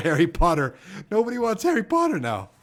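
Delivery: ordinary delivery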